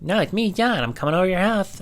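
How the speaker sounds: put-on high, squeaky twelve-year-old boy; kid voice John. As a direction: play a pesky little kid on the phone. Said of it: "call up as this guy, John".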